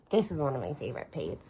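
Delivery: ordinary talk, nothing marked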